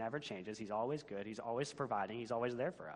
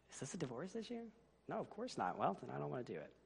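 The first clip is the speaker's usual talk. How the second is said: a hint of the high husband voice, softer; lightly pitched-up husband shrugging off the trash; lazy husband; 3 semitones higher, 5 dB quieter than usual. Husband brushes off his wife about the trash, lightly pitched up.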